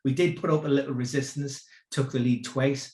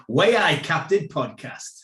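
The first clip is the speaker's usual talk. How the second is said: slow, louder Geordie with a clear "Why"; Geordie accent; 3 semitones higher, 6 dB louder, slower than usual. Shown as a demonstration. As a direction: show how Geordie really sounds. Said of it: "good effort".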